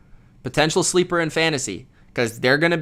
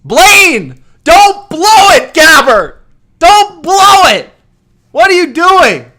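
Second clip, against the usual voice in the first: high, full-volume fan yelling; exasperated shouting; 14 dB louder, much slower than usual. Real, not acted. Fan yells at his quarterback after an interception, exasperated shouting. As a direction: scream at the quarterback as if he could hear.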